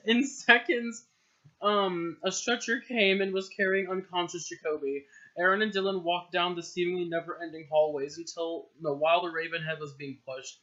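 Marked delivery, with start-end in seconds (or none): none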